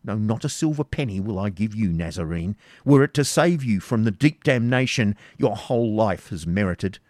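desperate defiance. Acted. Isaac defying the baron's torture, desperate and loud. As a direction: defy him with everything. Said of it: "rendered desperate by paternal affection".

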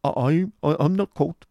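put-on high, stammering frightened boy; quick, timid reply. A narrator's reading. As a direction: blurt it nervously in a high voice. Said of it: "replied Smike quickly".

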